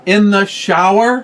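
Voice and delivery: can't believe she'd ask that; drawn-out indignant rise; incredulous. Real, not acted. Bickering about the phone.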